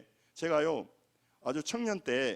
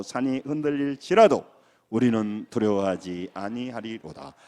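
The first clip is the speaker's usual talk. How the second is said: scripture reading in unison; 5 semitones lower, 5 dB louder than usual. Shown as a demonstration. Pastor leading the congregation reading Psalm 46 together, firm and steady. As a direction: read the psalm aloud with the church, firm and measured. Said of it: "같이 읽습니다. 시작".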